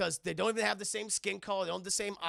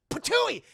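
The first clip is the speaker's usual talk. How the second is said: loud, high cartoon spit; mock spitting; 13 semitones higher, 10 dB louder than usual. Katana spits in her captor's face, loud mock spit. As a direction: spit it out explosively, hammed up. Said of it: "she spits right in his face".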